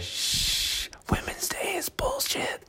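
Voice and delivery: sneaky whispered joke. Acted. Cracking a naughty joke on the podcast, whispered. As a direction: whisper it like a forbidden secret.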